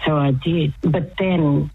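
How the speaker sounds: ordinary delivery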